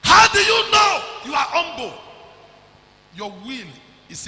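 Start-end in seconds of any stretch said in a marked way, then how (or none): 0.0-1.9 full-voiced preaching shout at the crowd. Real, not acted. Preacher shouting a question at the congregation.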